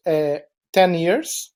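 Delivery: ordinary delivery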